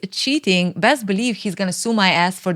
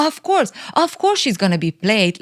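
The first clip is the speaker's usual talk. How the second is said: normal talking voice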